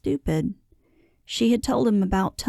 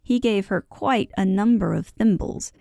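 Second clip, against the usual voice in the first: usual speaking voice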